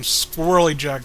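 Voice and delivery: ordinary talk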